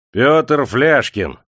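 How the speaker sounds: loud, high, drawn-out name call; calling the roll. A narrator's reading. Clerk calls a worker from his list, loud. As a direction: call the name out across the crowd.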